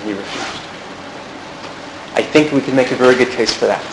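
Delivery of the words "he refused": much softer, almost under the breath; He refused, dropped to a hush; quiet emphasis. Real, not acted. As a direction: let it land softly.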